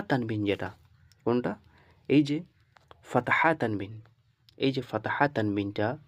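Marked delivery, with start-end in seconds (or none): none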